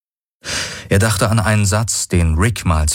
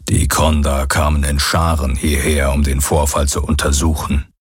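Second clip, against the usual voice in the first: Hox voice; 4 semitones lower than usual. Acted. The Aur Hox tells history.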